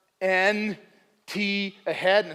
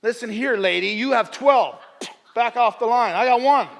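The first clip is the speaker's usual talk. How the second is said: acted telling-off, raised voice; confrontational attitude; 3 semitones higher, 5 dB louder than usual. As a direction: tell off a queue-jumper, loud and forceful. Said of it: "with that kind of attitude".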